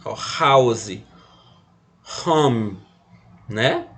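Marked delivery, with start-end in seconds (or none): 0.4-2.8 "house" and "home" with an English h; "house" and "home" with an aspirated h as in English; English words. Shown as a demonstration. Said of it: "ele tem som de R".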